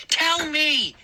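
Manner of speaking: high, forceful repeated order; insistent demand. Acted. Moose leaning over the boy, insistent and high. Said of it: "he repeated".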